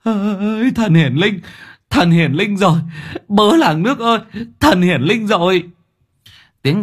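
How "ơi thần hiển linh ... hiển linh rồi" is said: excited shouting, voice pitched high. Acted. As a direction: yell it out to the whole village. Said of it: "miệng gào thét".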